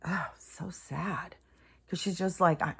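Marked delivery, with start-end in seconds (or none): 0.0-1.4 softer, fading into a whispered mock sob; imitating a crying girl. Retelling a girl bawling in her video, soft mock-tearful whisper. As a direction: echo her tearful plea, then let it sink to a whisper. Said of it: "And she's like".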